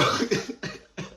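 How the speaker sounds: unvoiced wheezy laugh; breathy laugh. Real, not acted. Laughing at story.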